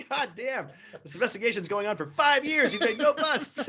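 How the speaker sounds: high laughing mock scolding; exasperated chief. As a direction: scold him through giggles.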